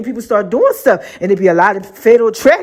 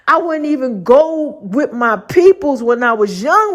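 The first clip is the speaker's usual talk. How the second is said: ordinary talk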